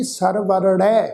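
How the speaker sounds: ਸਰਵਰੜੈ said very slowly, syllable by syllable; ਸਰਵਰੜੈ carefully drawn out; correct reading. Shown as a demonstration. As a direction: model ਸਰਵਰੜੈ slowly, sounding the ੜ clearly. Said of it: "ਪਾਠ ਕੀ ਹੈ ਜੀ?".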